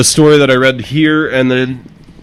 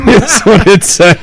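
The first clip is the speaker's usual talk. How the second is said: higher, drawn-out, through laughter; laughing; 9 semitones higher than usual. Real, not acted. Reader amused by the police report, laughing. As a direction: get it out while laughing.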